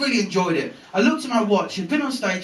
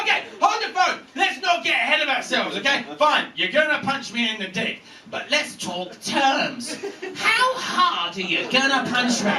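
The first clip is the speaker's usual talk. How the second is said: high-pitched flustered negotiating, slowing into the terms; frantic bargaining; 7 semitones higher than usual. Retold or imitated. Homeowner negotiating with the puncher, mock-frantic high voice. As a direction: haggle desperately in a raised voice.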